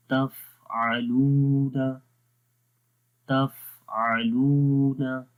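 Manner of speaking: very slow तफ़अलूना, the फ sound breathy with air running on; Quranic recitation demo. Shown as a demonstration. Teacher shows students slow recitation of तफ़अलूना. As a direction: stretch out तफ़अलूना letting breath flow through the फ. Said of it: "फा की आवाज़ को गौर से देखें".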